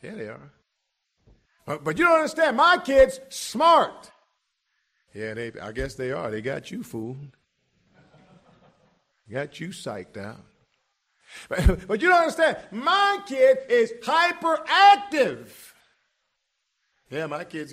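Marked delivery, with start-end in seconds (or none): 1.6-4.1 whiny parent objection. Retold or imitated. Preacher mimicking a parent who insists, high and loud. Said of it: "you say". 11.5-15.5 whiny parent objection. Retold or imitated. Preacher mimicking a parent who insists, high and loud. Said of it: "you say".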